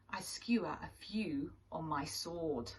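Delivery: soft sly whisper. Acted. Swordfish confides his hunting to the children, whispered. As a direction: breathe it like a wicked secret.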